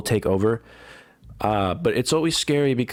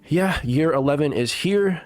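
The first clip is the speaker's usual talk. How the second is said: normal talking voice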